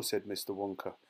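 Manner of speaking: ordinary delivery, nothing marked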